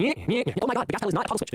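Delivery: high-pitched mocking nyehs and complaint; exasperated high-voiced send-up; mock exasperation. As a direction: raise the pitch sharply to mock bad drivers. Mocks jerky pedal use by drivers.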